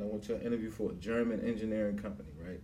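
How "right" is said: ordinary talk, nothing marked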